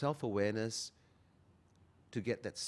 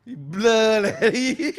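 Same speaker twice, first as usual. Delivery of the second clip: loud teasing; 12 semitones higher, 17 dB louder, much slower than usual. Real, not acted. Teasing banter after the show ends.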